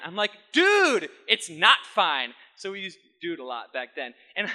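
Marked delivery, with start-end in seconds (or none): 0.5-2.3 a firm, raised retort to a friend; insistent shout. Retold or imitated. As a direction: push back hard on a friend. Insisting against friend's dismissal.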